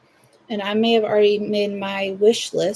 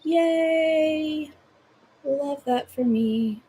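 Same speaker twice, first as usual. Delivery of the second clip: mock delight; 8 semitones higher, much slower than usual. Mock delighted reaction to pink cap.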